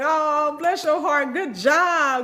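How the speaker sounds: high, sing-song mock soothing; patronizing comfort. Comforting an overwhelmed newcomer, high sing-song mock reassurance. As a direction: pat them on the head with your voice. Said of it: "I'll be like".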